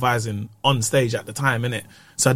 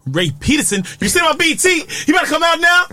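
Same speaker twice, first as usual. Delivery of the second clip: MC hype shout; 13 semitones higher, faster than usual. Pretend. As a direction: holler the comic's name to the crowd. MC bringing comic on stage.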